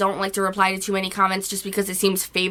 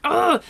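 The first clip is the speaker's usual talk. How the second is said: higher, frustrated "ugh"; exasperated groan; 8 semitones higher than usual. Acted. Creator reacting to hurtful criticism, exasperated groan. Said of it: "it really upsets you".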